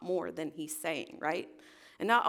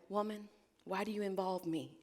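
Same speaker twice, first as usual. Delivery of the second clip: soft, dismissive reading of the line; hushed, dismissive retort; dismissive quote; 7 dB quieter than usual. Retold or imitated. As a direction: brush her off quietly. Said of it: "She's, like, dismissive of his".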